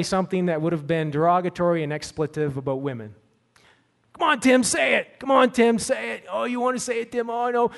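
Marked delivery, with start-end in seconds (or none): 4.1-7.7 high teasing schoolyard taunt; taunting kids. Retold or imitated. Bus kids goading Tim, high mocking taunt. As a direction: egg him on like a bus bully.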